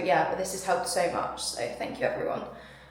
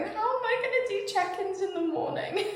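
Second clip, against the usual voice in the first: high, wobbly retold sob through a laugh; hammed-up tearful whine; laughing as she imitates her crying self; mock crying wail; 11 semitones higher than usual. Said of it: "all I was crying and going".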